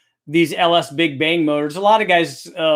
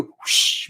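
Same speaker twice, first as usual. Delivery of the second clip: airy whoosh of rushing air; imitated whoosh; 4 dB quieter than usual. Shown as a demonstration. Explaining turbo blow-through to viewers, imitated whoosh. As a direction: make a rushing-air noise.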